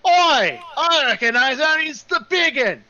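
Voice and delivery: loud, high-pitched excited yell; shout of recognition. Acted. Someone in the mob spots the big one, high excited shout. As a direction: holler it out over a crowd. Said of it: "And then you suddenly hear".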